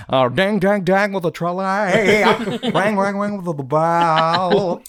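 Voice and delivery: slow hammed-up old radio voice; 1950s narrator. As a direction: croon it like vintage radio. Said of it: "That old time radio voice".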